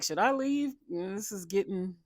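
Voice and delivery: squirm out of the room; mock awkward. Madison uncomfortable at the foot licking, high uneasy quote. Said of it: "Madison's like".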